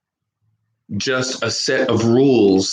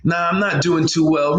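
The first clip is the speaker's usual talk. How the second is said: a touch higher, casual reply; mildly acted casual answer; lightly voiced friend; 6 semitones higher than usual. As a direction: answer like a buddy venting. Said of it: "how we talk in America".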